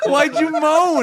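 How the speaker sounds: high-pitched laughing question; cracking up while asking; laughing hard. Real, not acted. Laughing at ghost impression.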